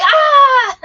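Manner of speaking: high, loud fangirl scream; fan scream. Retold or imitated. Middle-aged fans screaming at the band, a loud high shriek. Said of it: "We're like".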